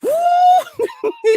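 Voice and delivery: high, loud drawn-out whoop; long falsetto car-like whoo; imitated angry whoop. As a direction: mimic his mad noise as a comic high hoot. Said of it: "that's how he sounded".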